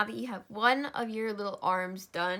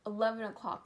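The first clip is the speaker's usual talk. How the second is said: ordinary delivery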